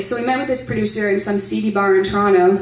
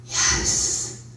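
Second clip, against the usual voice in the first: eerie drawn-out whisper; ghost voice; 4 dB quieter than usual. Retold or imitated. Guest speaker imitates a ghost caught on tape, long breathy whisper. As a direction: whisper it slowly, right into the microphone. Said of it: "a voice right in the microphone".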